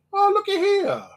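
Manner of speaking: high, put-on backwoods Canadian blacksmith voice; Carmen Legge impression. Imitating the blacksmith for the stream chat, high and hammed-up. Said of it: "he goes".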